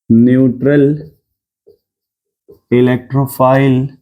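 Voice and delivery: very slow, one word at a time; note dictation. Shown as a demonstration. Teacher giving students the second note, slow dictation. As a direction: dictate with long gaps for writing.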